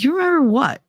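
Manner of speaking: high, sudden "You remember what?"; surprised question. Retold or imitated. Mother startled by her son's memory, high and quick. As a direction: caught off guard, asking sharply.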